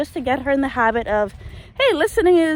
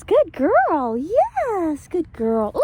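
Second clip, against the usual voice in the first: excited dog praise; 6 semitones higher, much slower than usual. Real, not acted. Praising dog for good behaviour.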